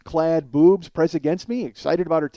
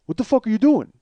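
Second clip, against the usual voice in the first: higher, indignant mimicked demand; angry challenge; 6 semitones higher, 4 dB louder than usual. Passenger challenges a man stopped in traffic, angry. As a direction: confront him from the car. Retells angry stranger in passing car.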